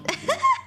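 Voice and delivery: short high-pitched real laugh; a quick burst of high laughter; amused laugh. Real, not acted. Streamer tickled by her own timestamp, amused laugh.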